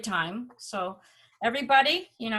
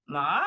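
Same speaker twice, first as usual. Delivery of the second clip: "Ma" dropping low, then climbing back up; roller-coaster the vowel in "Ma", down then up; third-tone dip; 7 semitones lower than usual. Shown as a demonstration. Showing Mandarin third tone, "Ma" dipping then rising. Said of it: "drop down and then go up".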